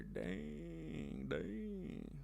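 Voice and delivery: breathy, fading dang; hushed disappointed dang; soft dang. Real, not acted. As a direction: let it sink out quietly. Soft reaction to the weather.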